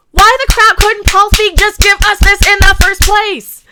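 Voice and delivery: loud, high-pitched exasperated outburst; real angry rant. Real, not acted. Fan venting at a director over a ruined franchise, angry rant. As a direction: rant at full voice, fed up and pleading. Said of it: "in the middle of a, a little bit of a rant".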